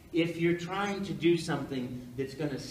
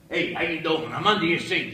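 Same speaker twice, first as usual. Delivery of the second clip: put-on loud Brooklyn plumber accent; brash Italian-American Brooklyn plumber, voice raised; 8 dB louder than usual. Actor trying out a plumber for an audition, loud Brooklyn accent. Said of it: "Italian plumber from Brooklyn".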